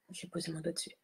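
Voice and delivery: very soft, whispered side remark; whispered aside. Real, not acted. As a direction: whisper it quickly to yourself. Muttered aside about a slip. (aparté chuchoté)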